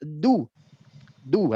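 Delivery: DU with a firm, held d; low, firmly stopped du; pressed d. Shown as a demonstration. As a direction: press the d of du before release. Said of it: "Harusnya ditekan".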